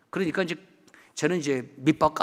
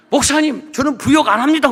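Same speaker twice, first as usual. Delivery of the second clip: higher, louder indignant retort; irate elder; 7 semitones higher, 8 dB louder than usual. Retold or imitated. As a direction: throw it back like an offended elder. Angry elder confronting the pastor. (화난 장로 흉내, 높고 크게)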